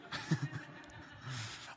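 quiet breathy chuckle; amused little laugh; soft laugh. Real, not acted. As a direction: laugh softly at the thought. Laughs after the punchline.

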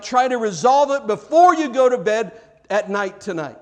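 high, loud preacher's exhortation; emphatic urging. Acted. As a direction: press each command home with force. Preacher voicing the Bible's urgent command.

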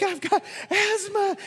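put-on very high-pitched little-girl protest; imitated little girl. Little girl with asthma to leader while fleeing, imitated high plea. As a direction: mimic a panicked child pleading while running. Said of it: "there's one little girl".

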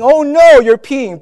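high, loud mock-alarmed cry; big "oh no" panic, played for laughs; acted alarm. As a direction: exclaim in exaggerated dismay. Acted reaction to baby peeing.

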